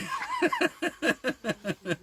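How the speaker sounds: a burst of high giggling; real high-pitched giggle. Real, not acted. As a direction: giggle helplessly at the dog. Dog pooing in shot.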